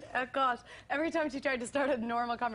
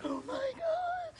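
much higher, slow, breathless starstruck; starstruck gasp; much slower than usual. Retold or imitated. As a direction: gush like a starstruck fan. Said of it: "I was like".

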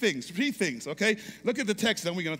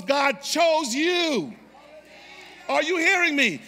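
building to a loud, high, sung-out climax; preaching at full force; 5 semitones higher, 6 dB louder than usual. Acted. Pastor whips the congregation into a climax, loud and soaring. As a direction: preach it up until it rings.

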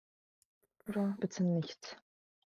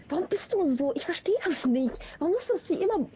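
exasperated complaint; 8 semitones higher than usual. Real, not acted. Exasperated at teasing friend.

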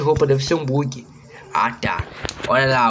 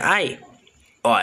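usual speaking voice

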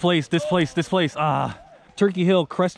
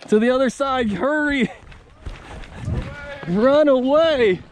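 panicked yelling; 9 semitones higher, slower than usual. Real, not acted. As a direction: yell at the squad to flee. Fleeing enemy squad.

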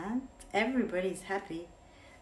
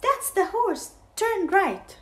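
much higher, louder thief's whisper-call; 13 semitones higher, 8 dB louder than usual. Acted. Thief Len telling Hugh where to go, high and loud. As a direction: squeak it out like a sneaky thief. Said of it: "And Len said".

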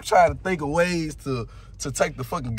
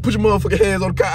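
louder, fast barked order; a cop repeating the order, now louder and fast; cop losing patience; 5 dB louder, much faster than usual. Retold or imitated. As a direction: snap the order out quickly and loudly. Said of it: "He said".

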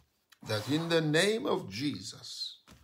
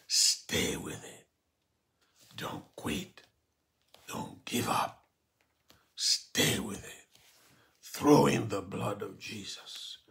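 urgent whisper; 2 semitones lower than usual. Real, not acted. Pastor urging the weary listener not to quit, whispered. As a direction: whisper it like a secret encouragement.

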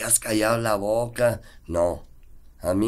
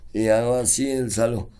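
usual speaking voice

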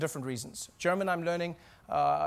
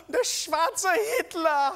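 high, slow, drawn-out mock shock; stunned German saleswoman; 10 semitones higher, 4 dB louder, much slower than usual. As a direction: imitate a woman aghast at a customer. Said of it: "looked at me and she said".

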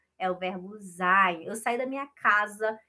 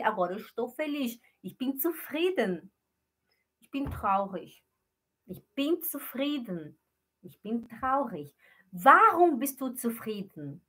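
ordinary talk, nothing marked

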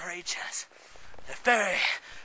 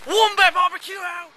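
loud sign-off; 10 semitones higher, 7 dB louder than usual. Pretend.

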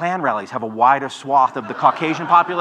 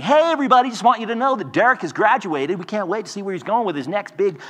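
high, bright-faked corporate cheer, louder; hammed-up upbeat HR announcement; mock cheery email; 7 semitones higher, 4 dB louder than usual. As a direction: sell a firing as a celebration. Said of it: "these cheery emails saying".

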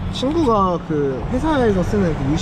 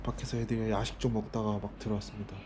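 much softer, low night talk; quiet at night; 5 semitones lower, 13 dB quieter than usual. Real, not acted. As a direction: keep it hushed, people nearby. Late night, people nearby.

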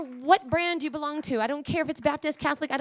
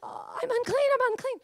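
Isaiah; 12 semitones higher than usual. Acted. Prophet falling before God's glory, high and fearful. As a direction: cry it out as if scared out of your mind. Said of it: "And he said".

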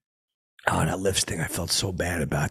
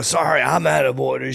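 higher, louder mock outburst; joking movie-line impression; 5 semitones higher, 7 dB louder than usual. Fan trying to crack up the star, hammed-up loud quote. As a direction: belt out a famous line for laughs. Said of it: "I'm trying to crack him up".

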